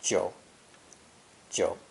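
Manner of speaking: "jiu" dropped low; low dipping third tone. Shown as a demonstration. Teacher introducing the final character, "jiu" in a low dipping tone. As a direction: let the voice dip down low on "jiu". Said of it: "belongs to the third low dipping tone".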